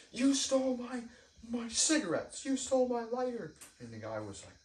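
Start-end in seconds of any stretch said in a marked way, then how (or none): 0.1-3.5 much higher and louder, furious accusing yell; shrill voice cracking with rage at the thief; angry screaming accusation. Retold or imitated. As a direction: scream the accusation at a stranger who just woke up. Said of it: "he was screaming at, at somebody".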